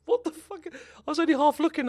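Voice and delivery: high, startled cry of disbelief; shocked outburst. Real, not acted. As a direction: blurt it out in sudden shock. Shocked at the game.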